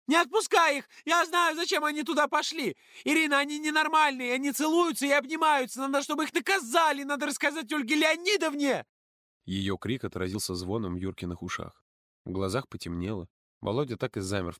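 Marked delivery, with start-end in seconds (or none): 0.1-8.9 put-on high, loud hysterical girl's scream; shrieking girl. A narrator's reading. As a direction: scream it out, much higher and louder. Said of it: "закричала во весь голос". (Маша кричит во весь голос)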